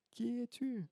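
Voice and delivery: high, soft faraway repeat; the question coming back as an echo; faint distant echo. Acted. Echo answers the girl.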